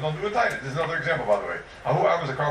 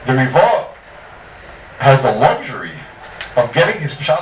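emphatic declaration; 8 dB louder than usual. Real, not acted. Emphatic teaching point.

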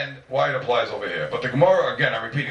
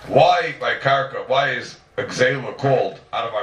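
slow and louder, word by word; half speed and louder for emphasis; slow repeat; 4 dB louder, slower than usual. Shown as a demonstration. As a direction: repeat it slowly, leaning in. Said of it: "I repeat".

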